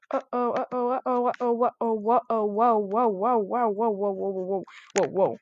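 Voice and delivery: nervous chant of alarm as balloons close in; panicked uh-ohs. Real, not acted. Gamer to viewers, repeating uh-oh then whoa in real alarm.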